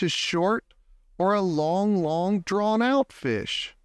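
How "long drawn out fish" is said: plain reading voice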